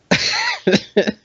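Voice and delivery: very high squeal of laughter. Real, not acted. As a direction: let out a shrieking giggle. Laughing hard.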